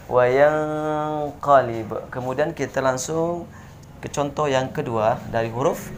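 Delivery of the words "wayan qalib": wayan qalib with the n hummed long, slow; correct nasal hold. Shown as a demonstration. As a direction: hum two beats before qalib. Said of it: "tekan dua harakat sekali lagi". (wayan qalib dengan dengung dua harokat)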